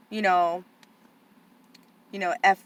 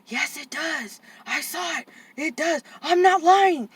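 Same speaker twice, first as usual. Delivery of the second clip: put-on upset boy; 8 semitones higher, slower than usual. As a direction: insist higher and higher until it bursts. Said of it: "he's going".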